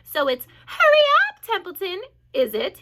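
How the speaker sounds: high, loud mocking copy of the pig; mock the pig's squeal; imitating Wilbur. A narrator's reading. Rat mocking Wilbur's pleading voice, high and loud. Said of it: "began imitating Wilbur's voice".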